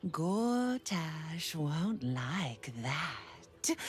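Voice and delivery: unvoiced, slow menacing whisper; whispered threat. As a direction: breathe the threat, barely voiced.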